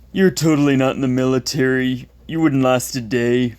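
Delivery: taunting schoolyard bully voice; mocking bully. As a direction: jeer like an online troll. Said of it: "the ones that I, I quoted".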